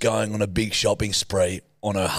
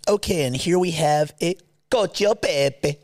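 higher American host leaning into Italian; over-Italian food names; 7 semitones higher than usual. Retold or imitated. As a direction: imitate an American overdoing the Italian dish name. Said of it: "Are you one of those Italians".

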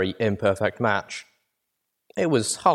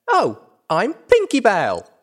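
high, loud, drawn-out self-introduction; bubbly little-girl glee; giggly, excited; 12 semitones higher, 6 dB louder, slower than usual. A narrator's reading. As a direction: introduce yourself like a bubbly filly. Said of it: "Giggling again".

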